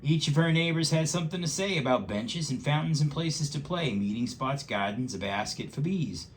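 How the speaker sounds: lively rhyming storybook read; reading to a child. Acted. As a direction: read it aloud with bouncy rhythm. Reads picture book aloud.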